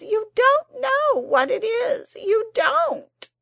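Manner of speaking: high, loud, crying plea breaking; voice cracking. A narrator's reading. The Psammead sobs to Anthea, voice cracking. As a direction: let the voice crack into a sob. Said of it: "Its voice cracked with emotion".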